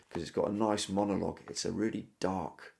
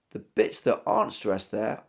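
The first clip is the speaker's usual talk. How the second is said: ordinary talk, nothing marked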